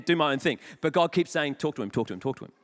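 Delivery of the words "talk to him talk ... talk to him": low insistent God voice. Retold or imitated. God's insistent voice.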